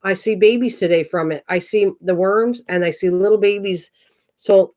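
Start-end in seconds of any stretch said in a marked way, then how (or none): none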